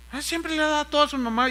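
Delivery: exaggerated complaint; complaining. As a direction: mimic someone griping about favoritism. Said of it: "gente que viene y se queja".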